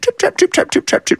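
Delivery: high then whispered trip trap; quick tiptoeing footsteps voiced. A narrator's reading. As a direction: patter the footsteps lightly. Mother goat hurrying down path.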